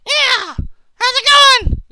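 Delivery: very high, loud squeaky voice; squeaky high caller voice; silly phone voice. Pretend. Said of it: "it's Outright Lie".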